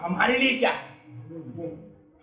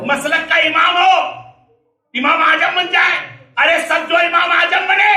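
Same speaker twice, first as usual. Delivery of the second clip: raised sermon cry, slowing at the end; full-force preaching; 4 semitones higher than usual. Acted. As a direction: proclaim it to the congregation. (پورے جوش سے خطابت)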